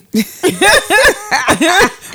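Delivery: bursting out laughing; a big real laugh; loud laugh. Real, not acted. Laughs at the tweet.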